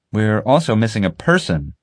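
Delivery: ordinary delivery, nothing marked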